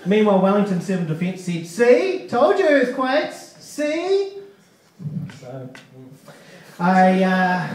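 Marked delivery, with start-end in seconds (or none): 1.7-4.3 high, loud smug gloating; smug told-you-so. Retold or imitated. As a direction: gloat like you were proven right. Mocking Wellington officials.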